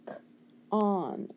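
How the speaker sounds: "K", a gap, then "on"; short O sound. Shown as a demonstration. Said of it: "gonna be a short O".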